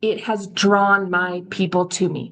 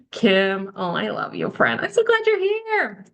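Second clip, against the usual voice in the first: delighted greeting. Real, not acted. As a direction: greet a dear friend, pitch soaring at the end. Greeting a friend joining the Zoom.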